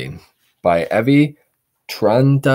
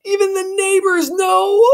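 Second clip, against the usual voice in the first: put-on very high, shocked boy; dismayed boy; 5 dB louder than usual. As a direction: gasp it in dismay.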